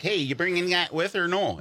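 put-on Wisconsin accent. Newcomer to L A asking locals, Wisconsin accent. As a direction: ask it in a folksy Wisconsin accent. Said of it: "I have an accent".

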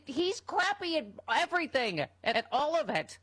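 real exasperated yelling; screaming rant. Real, not acted. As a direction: get worked up and yell it. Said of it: "Sorry, I'm screaming".

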